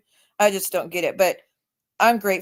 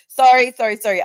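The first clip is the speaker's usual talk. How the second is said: sudden louder, higher "sorry"; loud apology; 3 semitones higher, 8 dB louder than usual. Real, not acted. Apologising for a weird remark, loud quick outburst. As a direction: burst out with repeated apologies.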